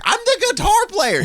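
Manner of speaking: very high, stretched mock protest; slow high-pitched retort, hammed up; indignant guitarist. Acted quarrel, guitarist answering the lady.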